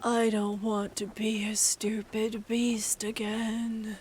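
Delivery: slow, hushed monster half-whisper; creature voice. Acted. Cursed creature confessing to the party, slow hushed creature voice. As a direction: softly draw out each word as a weary beast. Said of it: "begins to talk, and it says".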